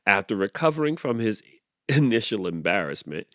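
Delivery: ordinary delivery, nothing marked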